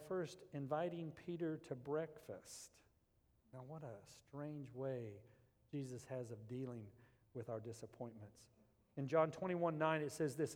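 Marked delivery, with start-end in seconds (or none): none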